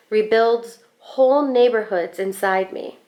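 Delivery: plain reading voice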